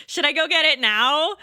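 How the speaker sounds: high, loud rising question; hammed-up uncertain query, climbing at the end; retold reluctant asking. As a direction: play up a pleading question, pitched right up. Acted question repeated more anxiously.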